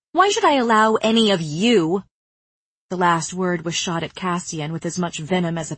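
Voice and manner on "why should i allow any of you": sharp, louder, higher outburst; venomous. Acted. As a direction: spit the words out.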